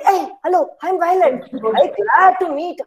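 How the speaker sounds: lilting storybook greeting; cheery greeting. Acted. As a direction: introduce yourself eagerly to new neighbours. Colours introduce themselves to neighbours.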